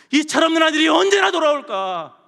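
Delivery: loud, high-pitched cry of longing; 기다리는 아버지의 외침. Acted. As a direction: call out as a waiting father. Waiting father cries out. (크고 높게 외치는 탄식)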